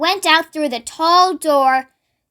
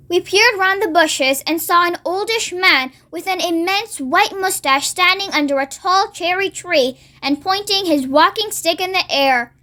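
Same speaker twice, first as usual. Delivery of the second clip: plain reading voice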